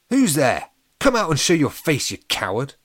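loud, high shout into the dark; shouted challenge. A narrator's reading. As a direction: call out the hidden attacker. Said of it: "Alex shouted".

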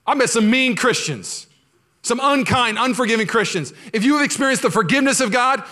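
high, loud fervent preaching; preaching at full force. Acted. Pastor rousing the church about forgiving Christians, fervent. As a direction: drive it home to the congregation.

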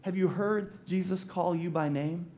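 normal talking voice

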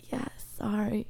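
tiny voiceless apology; answering the guards in a whisper; sheepish whisper. Retold or imitated. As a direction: whisper it, embarrassed. Her embarrassed answer, whispered.

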